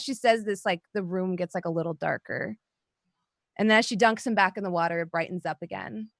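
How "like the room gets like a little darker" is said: ordinary delivery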